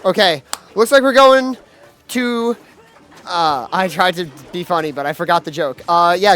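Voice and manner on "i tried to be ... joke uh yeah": usual speaking voice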